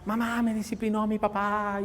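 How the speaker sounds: lightly voiced complaining child; mild mock child's complaint; whiny child. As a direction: tattle to mom, a hint of whining. Child runs whining to mother.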